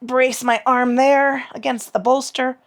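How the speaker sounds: ordinary talk, nothing marked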